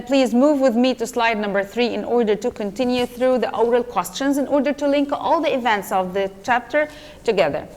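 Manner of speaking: normal talking voice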